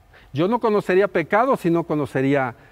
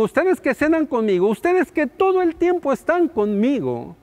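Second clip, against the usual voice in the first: rising higher and louder with each line; Jesus pleading, building; 7 semitones higher, 4 dB louder than usual. Retold or imitated. Preacher voicing Jesus to his disciples, building up higher.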